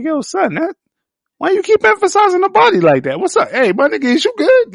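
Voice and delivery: high, loud, fast disbelieving yell; incredulous mock call-out. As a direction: shout at the screen in disbelief. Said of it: "But I was like".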